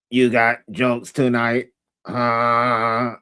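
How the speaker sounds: low, slow deadpan imitation; dry robotic mimicry. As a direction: tease by copying a robotic talker. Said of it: "that's all you be saying".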